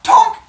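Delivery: loud, sudden tonk; imitated tonk. Chipmunk hits elephant's forehead, loud mock tonk. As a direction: punch out the pebble's knock.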